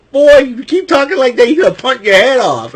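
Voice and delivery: high, loud mock tough-guy warning; mock threat. Jimmy Uso warning R-Truth, high and loud mock threat. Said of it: "Jimmy Uso looks at R-Truth".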